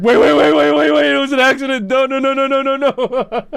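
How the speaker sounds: high, loud frantic begging, slowing at the end; panicked pleading. Retold or imitated. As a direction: beg them to stop in a rising panic. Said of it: "we were trying to, like".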